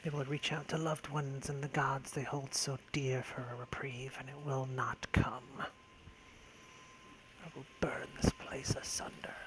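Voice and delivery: slow sinister whisper, barely voiced; menacing villain whisper. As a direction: hiss the threat like a dark sorcerer.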